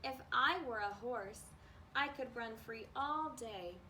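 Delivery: ordinary delivery, nothing marked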